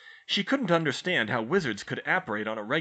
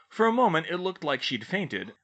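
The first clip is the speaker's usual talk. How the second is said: usual speaking voice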